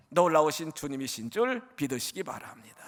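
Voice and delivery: ordinary delivery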